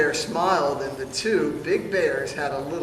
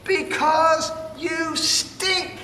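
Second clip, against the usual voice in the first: much higher, slow teasing shout; 10 semitones higher, much slower than usual. Acted. Fluffy bear teasing smelly bear, high slow shout. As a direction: drag out the insult with a squeal. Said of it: "shouted Big Fluffy Bear".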